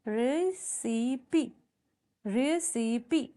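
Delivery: "रेसिपी" slowly drawn out as re, si, pee; syllable by syllable. Shown as a demonstration. Teacher counts vowel sounds for students, "रेसिपी" slowly split in three. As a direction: stretch "रेसिपी" into three separate syllables, both times. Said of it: "ई sound, three syllable".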